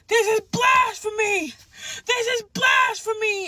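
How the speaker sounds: high, loud, drawn-out outraged yell. Retold or imitated. Yelling at the church mid-service during a manic episode, re-enacted outraged yell. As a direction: re-enact yelling an accusation at a congregation. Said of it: "I started yelling".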